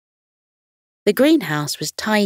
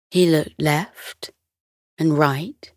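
plain reading voice

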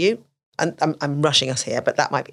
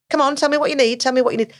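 higher, louder pushing parent; impatient demand; 9 semitones higher, 4 dB louder, faster than usual. Retold or imitated. Parent pressuring a child who can't say what it needs, impatient. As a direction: hurry the child along, exasperated.

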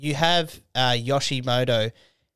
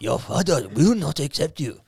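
mock Japanese elder; 3 semitones higher than usual. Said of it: "Stop that".

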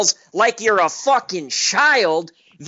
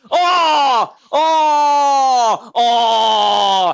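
mock crying; 3 dB louder, much slower than usual. Mocking crying over Favre.

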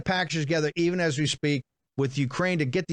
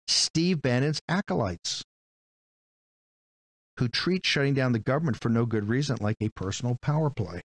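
ordinary talk

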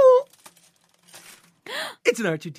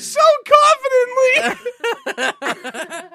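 laughing; 9 dB louder, much slower than usual. Real, not acted. Teasing through laughter.

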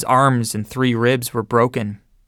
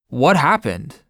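demanding; 3 semitones higher, slower than usual. Retold or imitated. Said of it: "the major demanded".

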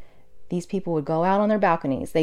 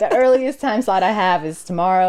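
louder New York accent imitation; Sal's New York accent; 6 dB louder than usual. Laundry guy telling a nurse his slot, New York accent. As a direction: put on a New Yorker's phone voice, loud. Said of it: "can't help but do Sal's accent".

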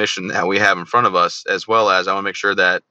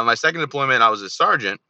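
normal talking voice

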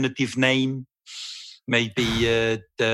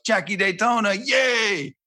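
high-pitched imitation of a cheering fan; mock excited fan; 9 semitones higher, slower than usual. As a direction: cheer it high, mimicking a gushing comment. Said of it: "someone goes".

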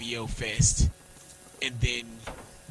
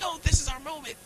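excited; 11 semitones higher, 4 dB quieter than usual. Retold or imitated. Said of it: "if, like, this is the one, like".

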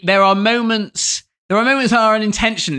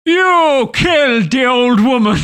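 higher, louder, slow accusation; hammed-up dramatic accusation; accusing quote; 7 semitones higher, 5 dB louder, slower than usual. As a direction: spell out the charge slowly and loudly. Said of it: "when he goes".

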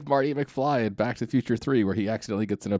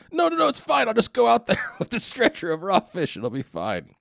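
mock Italian dude; 10 semitones higher, 3 dB louder than usual. Podcast guest mimics an overconfident Italian chef, high mock reassurance. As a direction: wave it all away in a high mock voice. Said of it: "that's these Italian dudes, though, right".

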